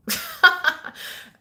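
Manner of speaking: high burst of laughter; real laugh. Real, not acted. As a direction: laugh off the comment. Laughing at a viewer's comment.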